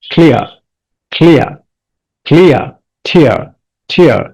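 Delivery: ক্লিয়ার and টিয়ার with the final R barely sounded; half-pronounced R. Shown as a demonstration. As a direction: say ক্লিয়ার, টিয়ার separately, each ending softened to "-ia". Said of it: "আরটা অর্ধেক উচ্চারণ হচ্ছে". (ক্লিয়ার ও টিয়ার-এর শেষের র অর্ধেক উচ্চারণ)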